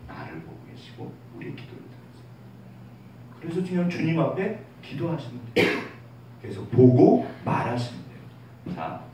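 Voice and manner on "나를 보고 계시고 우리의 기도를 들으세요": soft whisper. Real, not acted. Tender reassurance about God.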